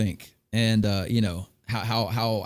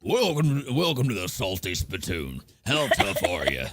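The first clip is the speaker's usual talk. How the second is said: low, slow gruff sailor voice; gruff tavern greeter; 5 semitones lower, slower than usual. Retold or imitated. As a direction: growl the welcome like a tough barkeep. Quoting Salty Spittoon greeter.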